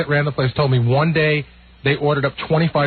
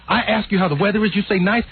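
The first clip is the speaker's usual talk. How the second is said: Soupy scolding; 7 semitones higher than usual. Retold or imitated. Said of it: "and he goes".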